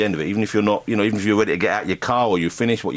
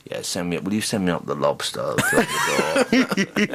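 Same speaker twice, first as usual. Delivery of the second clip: slow, laughing, lordly room-service order; pampered hotel guest; 3 semitones lower, slower than usual. Retold or imitated. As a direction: play a spoilt star ordering lobster. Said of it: "pick up the phone and go".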